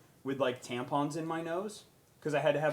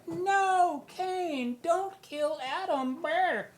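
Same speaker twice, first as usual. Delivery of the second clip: high pleading voice; 13 semitones higher, much slower than usual. Retold or imitated. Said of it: "I was like".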